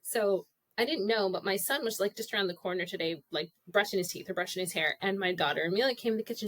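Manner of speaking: ordinary talk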